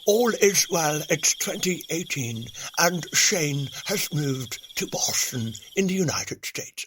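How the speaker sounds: slow, hushed nature-documentary narration; documentary narrator impression, slow and measured; David Attenborough. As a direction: narrate it like a wildlife film, slow and grand. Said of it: "I kind of think David Attenborough".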